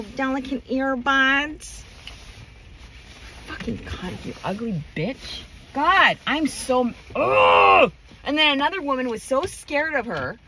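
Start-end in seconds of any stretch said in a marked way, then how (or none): 3.5-5.4 soft, under-the-breath cursing; muttered insult. Real, not acted. Cursing the rude woman under her breath, softly. 7.2-7.9 loud, low exasperated shout; frustrated groan. Real, not acted. Fed up with rude people, a loud exasperated groan.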